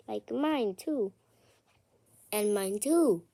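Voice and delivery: normal talking voice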